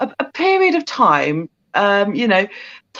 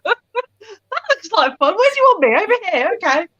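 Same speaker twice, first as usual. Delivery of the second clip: squeaky, much higher eager little cat; excited cat voice; 10 semitones higher, 3 dB louder than usual. Retold or imitated. Owner voicing her excited cat Zach, high squeaky voice. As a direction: play the thrilled pet begging to join in. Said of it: "Zach just got excited and was like".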